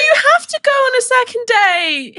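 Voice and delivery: very high, excited squeal, stretched out at the end; gushing insistence. Real, not acted. Friend urging her to see him a second time, gushing insistence. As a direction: plead high and thrilled, drawing out the last words.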